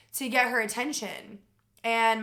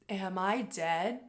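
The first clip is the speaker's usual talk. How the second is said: dazed question; 4 semitones lower, 6 dB quieter, slower than usual. Retold or imitated. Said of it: "I look at her and I go".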